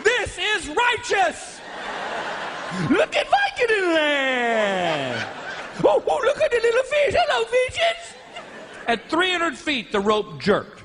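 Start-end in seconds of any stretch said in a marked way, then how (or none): 0.0-8.1 much higher, slow, giggling gush; giddy drugged delight. Retold or imitated. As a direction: float along blissed-out and giggling. High on Vicodin, flying.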